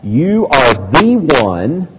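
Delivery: building, ringing proclamation; emphatic preached declaration; emphatic confession. Acted. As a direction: declare it with full preacher's conviction, pitch lifting. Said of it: "never more emphatic than this time".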